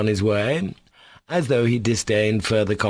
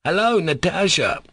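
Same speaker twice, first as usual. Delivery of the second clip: high-pitched, mildly raised call; 10 semitones higher than usual. A narrator's reading. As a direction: hail someone across the market, without a full shout. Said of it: "he shouted to a market woman".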